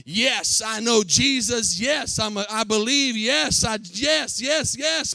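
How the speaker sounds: loud, eager voicing of Peter's claims; mock boast. Preacher voicing Peter's boast to the church, loud and eager. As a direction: shout the claims like a proud disciple.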